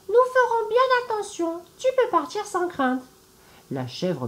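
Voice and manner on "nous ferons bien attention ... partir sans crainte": put-on much higher, childlike little kids' voice; little goat kids. Little goats reassure their mother, in a high childlike voice. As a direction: squeak it like tiny goats reassuring mum. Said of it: "répondirent les chevrons".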